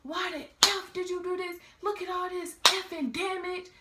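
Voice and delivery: high, loud, furious mom voice; imitating cussing mom. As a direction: rage at the damage like an angry mother. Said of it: "She was like".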